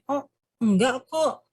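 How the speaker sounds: mismatched denial. Shown as a demonstration. Presenter showing words that clash with a nod, light denial. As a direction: deny it with a voice that contradicts the nod. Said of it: "Ada anggukan tapi ngomongnya".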